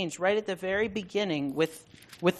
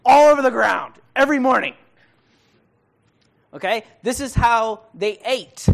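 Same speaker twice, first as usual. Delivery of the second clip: excited describing; 5 semitones higher, 12 dB louder than usual. Acted. Describing manna vividly.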